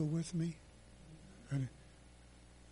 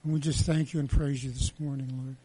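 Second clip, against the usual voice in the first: praying; 4 dB louder than usual. Acted. Pastor prays over the giving.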